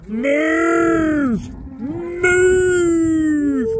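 high, loud drawn-out "Move"; long yelled command; shouting at cows. Real, not acted. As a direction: bellow at the herd to shift.

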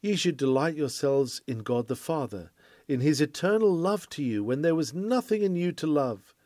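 usual speaking voice

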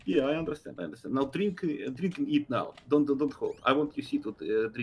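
put-on thick foreign accent, clipped broken grammar; broken English accent. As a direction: play a gruff foreign kidnapper with broken English. Broken-English criminal role throughout.

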